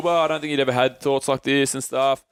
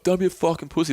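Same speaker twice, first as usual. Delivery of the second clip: higher-pitched retold telling-off; mock berating; 6 semitones higher than usual. Mate responds to his not having a good time, mock berating. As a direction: imitate a mate giving him a mild berating. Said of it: "basically started berating me".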